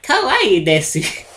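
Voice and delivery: slow, high cutesy weeb voice; excited anime fan. Pretend. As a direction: coo it like an anime superfan. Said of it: "being a massive weeb".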